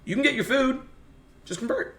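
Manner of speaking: high-pitched mocking imitation of a gatekeeper; mock English gatekeeper. Teller imitates English gatekeepers withholding famine food, high mocking voice. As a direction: pitch it way up, mimicking the people withholding help. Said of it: "the English were being gatekeepers and were like".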